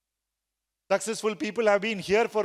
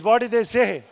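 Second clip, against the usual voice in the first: loud, emphatic question to the class; loud call to the room; 6 dB louder than usual. Shown as a demonstration. Trainer urging the class to answer loudly, loud. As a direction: throw it out so everyone answers. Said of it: "Loudly, please".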